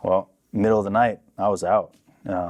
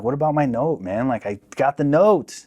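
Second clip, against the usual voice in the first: retold urgent protest; 6 semitones higher than usual. Son protesting to the cop about his note, urgent protest. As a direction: plead your case, re-enacting it.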